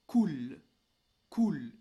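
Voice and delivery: slow, emphatic "cool" with a bare final L; final L without vowel. Shown as a demonstration. Teacher reading a soukoun word, final L without vowel. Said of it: "ça se lira".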